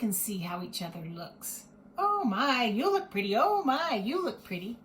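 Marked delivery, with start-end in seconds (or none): 2.0-4.8 admiring voice. Acted. Doll admiring herself in mirror.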